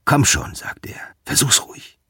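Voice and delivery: soft, half-whispered challenge face to face; hushed, daring him to swing; quiet taunt. Acted. Trossman taunts up close.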